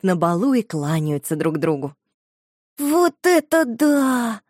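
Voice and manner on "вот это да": slow, high, amazed exclamation; gasping wonder. A narrator's reading. Shusha gasps at the dolls, slow and amazed. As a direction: marvel breathlessly at the dolls. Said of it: "ахнула Шуша".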